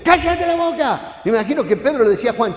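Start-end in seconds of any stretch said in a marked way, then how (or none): 0.0-1.2 high shouted scolding; regaño de los discípulos. Acted. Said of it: "los discípulos retaban al ciego". (regañando a gritos)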